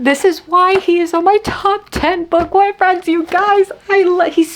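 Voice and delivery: high, slow fangirl squeal; excited gushing. Real, not acted. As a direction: squeal it like a swooning reader. Gushing over a book boyfriend.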